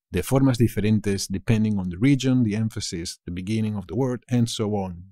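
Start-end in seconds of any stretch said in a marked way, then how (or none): none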